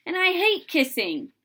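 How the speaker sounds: put-on loud, high disgusted kid. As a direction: spit out the disgust. Child disgusted.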